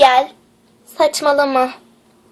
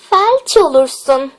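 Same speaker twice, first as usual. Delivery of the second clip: ordinary talk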